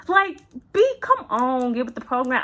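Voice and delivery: loud, high, laughing scold; mock fed-up, come on already; exasperated scolding. Exasperated at clueless husband.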